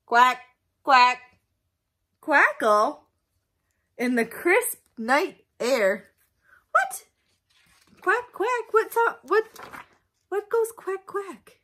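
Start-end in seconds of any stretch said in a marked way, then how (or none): 0.1-3.0 loud quacks. Acted. Duck sounds in story. 6.7-11.6 startled farmer. Acted. Farmer Brown confused.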